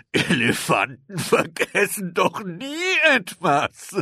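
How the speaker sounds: high, slow words through laughter; laughing elephants. A narrator's reading. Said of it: "lachten die Elefanten".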